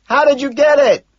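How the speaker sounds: high, loud yelled question; full-volume holler; screaming. A narrator's reading. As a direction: shout it over the crowd. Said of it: "I scream".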